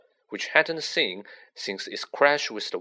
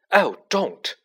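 higher, louder protesting wail; plaintive cry of protest, pitched up; 6 semitones higher, 5 dB louder than usual. A narrator's reading. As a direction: wail it in mild distress. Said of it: "she wailed every time".

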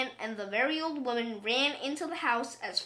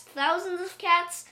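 plain reading voice

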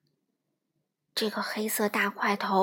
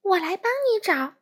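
high, louder eager voice; eager little fish; 8 semitones higher, 5 dB louder than usual. Acted. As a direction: promise to help, bright. Fish comforting whale.

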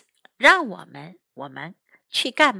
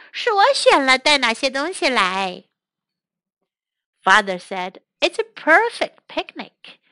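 animated bilingual storytime reading; children's story narration; 4 semitones higher, 4 dB louder than usual. Acted. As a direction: read a picture book aloud to young learners.

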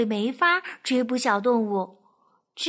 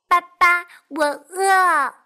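high, loud little voice; hungry duckling; 4 semitones higher, 7 dB louder, slower than usual. Acted. Duckling telling the fox it is hungry, high. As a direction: whine for food like a chick.

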